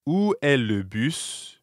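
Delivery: slow, spaced-out "Où est le bus"; slow model phrase. Shown as a demonstration. Teacher models the bus question for beginners, slowly. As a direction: say the French slowly for a learner.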